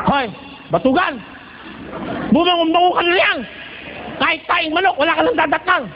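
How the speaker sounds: hammed-up wife rousing a lazy husband; nagging wife. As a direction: nag him out of bed like a fed-up wife. Wife nagging sleeping husband awake.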